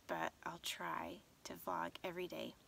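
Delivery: soft tired whisper; half-asleep whisper. Real, not acted. Sleepy late-night sign-off.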